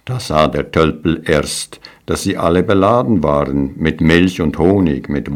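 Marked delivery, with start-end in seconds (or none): none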